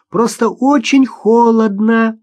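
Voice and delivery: put-on high, drawn-out child call; little girl's voice. A narrator's reading. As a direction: call out slowly as a small girl. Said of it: "раздался голосок Маши". (детский голосок, высоко и протяжно)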